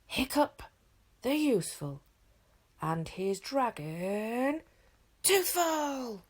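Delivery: a reluctant herald trying to sound grand; forced announcer yell. A narrator's reading. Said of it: "put a little bit of enthusiasm into his yelling".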